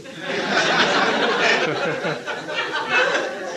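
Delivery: long, high, airy burst of laughter. Real, not acted. Director delighting in the stair cliché, high laugh. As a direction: let the laugh run free. Amused at the film convention.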